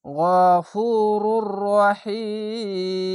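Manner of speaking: গফুরুর রহিম chanted very slowly, long vowels held; slow recitation. Shown as a demonstration. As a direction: stretch গফুরুর রহিম melodically. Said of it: "জেরের পাশে ইয়া সাকিন".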